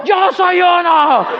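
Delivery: high, long-drawn mock yell; converted Marta. Marta swept up by the crowd, mock fervent yell. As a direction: cry it like a new convert. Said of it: "Marta estaba diciendo".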